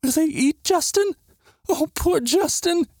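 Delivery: put-on very high, frightened child's whimper. A narrator's reading. Natalia hiding her face, fearing for Justin, whimpering. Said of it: "hid her face on my hand and whimpered".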